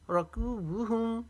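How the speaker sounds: रकूबुहुम with a drawn-out कू; long कू. Shown as a demonstration. Teacher showing wav madd, कू held long in रकूबुहुम. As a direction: stretch कू in रकूबुहुम, keep बु short. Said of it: "कू को एक अलिफ के जितना".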